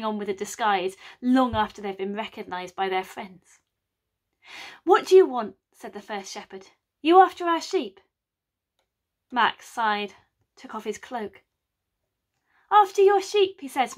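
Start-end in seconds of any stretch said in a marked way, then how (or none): 4.8-5.6 louder and higher, suspicious challenge; first shepherd challenging the sheep thief, loudly; demanding. Acted. Shepherd confronting Mac. 7.0-8.0 louder and higher, suspicious challenge; first shepherd challenging the sheep thief, loudly; demanding. Acted. Shepherd accusing Mac. 12.7-13.6 much higher and louder, feigned shock; mock outrage. Mac feigning innocence.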